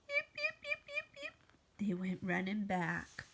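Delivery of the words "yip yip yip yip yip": very high little yips; squeaky high yapping; puppy yips. Acted. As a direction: yip like a tiny pup. Pup yips then runs off.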